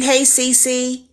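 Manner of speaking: ordinary talk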